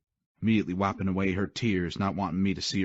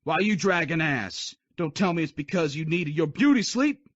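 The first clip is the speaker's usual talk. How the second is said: taunting drill sergeant; 9 semitones higher, 3 dB louder than usual. Acted. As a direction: needle him loudly.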